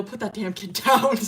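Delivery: laughing scold. Real, not acted.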